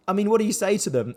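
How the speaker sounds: ordinary talk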